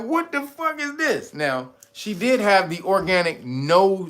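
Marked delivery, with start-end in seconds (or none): 0.0-1.3 baffled. Retold or imitated. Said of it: "She was like".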